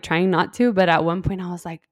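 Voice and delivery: ordinary talk, nothing marked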